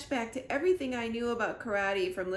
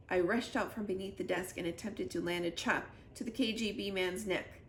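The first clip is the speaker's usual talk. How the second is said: plain reading voice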